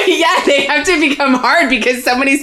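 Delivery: laughing. Real, not acted. Laughing outburst about breastfeeding.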